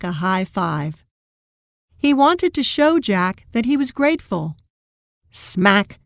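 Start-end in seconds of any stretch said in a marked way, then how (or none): none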